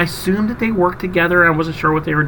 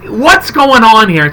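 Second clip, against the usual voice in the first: baffled; 6 semitones higher, 3 dB louder, much slower than usual. Retold or imitated. His own baffled reaction retold.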